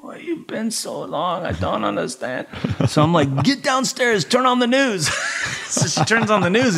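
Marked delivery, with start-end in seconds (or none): 0.0-2.5 soft, slow imitated Thai-accented English; wife's Thai accent. Wife in bed to returning husband, puzzled Thai accent. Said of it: "and she's like". 3.4-5.1 high-pitched re-enacted urging; exasperated order. Drained husband sending wife downstairs, mock-exasperated order.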